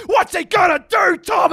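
high, loud commentary shout; re-enacted frantic yelling; screaming. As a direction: holler it while thumping the table. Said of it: "I'm screaming".

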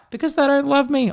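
put-on high small child's voice; sad little kid. Kid named covid tells the teacher about his parents, high child voice.

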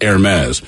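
Hermes stretched slowly as "air-MEZ"; slow Hermes with silent H, air-MEZ; French-style pronunciation. Shown as a demonstration. Host reading listener comments aloud, Hermes as slow air-MEZ. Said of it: "It's pronounced Hermes".

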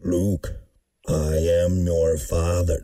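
very low, slow Vader rumble; Darth Vader impression. Co-host doing a deep, slow Darth Vader. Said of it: "That was pretty good".

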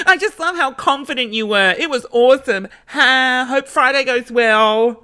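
very high, giggly fawning voice; hammed-up high-pitched flirty reply; mock gushing. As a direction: gush nervously way up high, stretching the sign-off. Acting out her own gushing reply.

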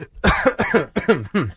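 choking cough; coughing fit. Real, not acted. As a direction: cough hard, caught off guard. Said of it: "I'm choking on that".

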